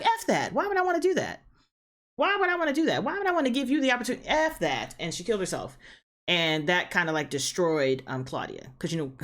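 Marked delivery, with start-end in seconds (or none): 0.0-4.9 much higher, indignant mock voice; mock vampire refusal. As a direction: scoff at the offer in a high, huffy voice. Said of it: "She was like".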